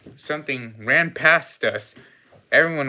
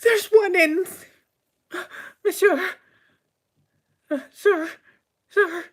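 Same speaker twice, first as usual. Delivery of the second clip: very high, squeaky, frightened voice; high falsetto panic; panicked waitress; 4 dB louder than usual. Acted. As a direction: gasp and squeal as a flustered waitress. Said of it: "I hear my waitress saying".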